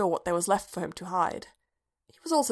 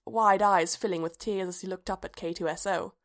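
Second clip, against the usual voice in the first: plain reading voice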